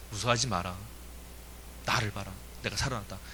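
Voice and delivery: low, soft, steady reassurance; a hint of a calm, low Jesus; lightly voiced Jesus. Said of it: "뭐라 그러세요?".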